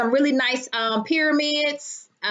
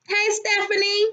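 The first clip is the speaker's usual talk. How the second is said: normal talking voice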